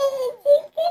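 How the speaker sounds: put-on high, slow sick child whining through tears; crying little girl. Sick little girl whining to her brother, tearful child voice. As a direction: whimper like a feverish little girl.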